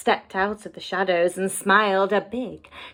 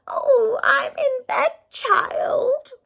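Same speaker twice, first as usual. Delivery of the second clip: much higher, quavery fake old lady voice; wolf's old lady voice; much slower than usual. A narrator's reading. Wolf in Grandma's bed faking an old lady, high and quavery. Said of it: "in his best old lady voice".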